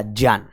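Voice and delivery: ज्ञान pronounced "jyaan", with j; corrected pronunciation. Shown as a demonstration. Teacher urging students to speak it purely, "jyaan". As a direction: model ज्ञान as a j word, "jyaan". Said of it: "इसे शुद्ध बोला करें".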